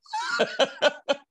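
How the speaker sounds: bright burst of real laughter; an amused high laugh at his own joke. Real, not acted.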